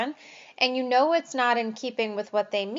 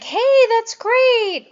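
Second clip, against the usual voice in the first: much higher and louder, slow, cartoonish "Hey, that's great"; over-the-top delighted voice; exaggerated cheer; 12 semitones higher, 10 dB louder, much slower than usual. Shown as a demonstration. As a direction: ham up the enthusiasm. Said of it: "instead of saying".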